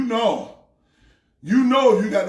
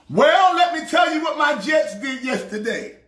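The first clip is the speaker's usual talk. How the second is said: usual speaking voice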